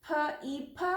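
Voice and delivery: "फ ई फ" as separate sounds, puh, ee, puh; sounding out letters. Shown as a demonstration. Teacher sounding out the word, letter by letter. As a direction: sound out "फ ई फ" one by one. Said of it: "The word is".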